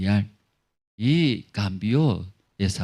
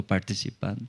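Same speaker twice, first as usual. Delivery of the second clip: ordinary talk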